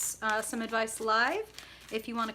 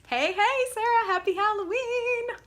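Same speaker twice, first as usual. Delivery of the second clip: much higher, louder sing-song hello; excited greeting; 12 semitones higher, 6 dB louder than usual. Real, not acted. Reader greets a viewer in chat, much higher excited hello. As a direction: beam a happy Halloween. Greeting a live chatter by name.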